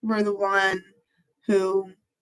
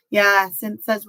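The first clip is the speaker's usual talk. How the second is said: ordinary talk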